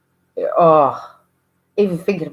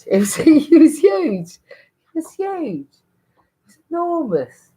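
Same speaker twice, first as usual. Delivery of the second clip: much higher, slow squeaky it was huge; exaggerated amazement; 12 semitones higher, 3 dB louder, slower than usual. Real, not acted. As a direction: stretch it high in disbelief. Exaggerating baby's size.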